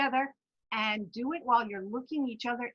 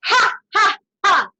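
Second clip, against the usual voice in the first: loud ha ha ha laugh; 9 semitones higher, 10 dB louder than usual. Shown as a demonstration. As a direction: bark out three open laughs. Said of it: "In you go".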